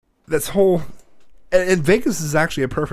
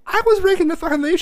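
high, loud whine of outrage; indignant protest; 6 dB louder than usual. Retold or imitated. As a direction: act out an outraged kid arguing back. Retold argument with mom over money.

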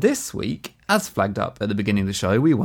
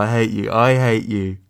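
"I hate you" stretched slow and louder; drawn-out mock hate; 4 dB louder, much slower than usual. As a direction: savour each word of a fake insult.

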